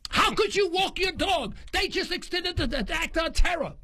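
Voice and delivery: pitched high, half laughing in disbelief; incredulous mock outrage. Asking the calm dog walker how she can stroll, high mock outrage. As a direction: scold the passer-by in amused outrage.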